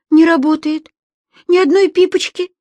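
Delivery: high, loud cry of panic with stress on НЕ; girl in the dark lift crying out in panic; panicked girl. Acted. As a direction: cry out that "Не работает" in fright. Girl finds phone dead.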